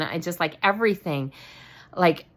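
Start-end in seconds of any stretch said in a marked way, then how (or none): 1.9-2.3 overwhelmed. Real, not acted. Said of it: "I was overwhelmed".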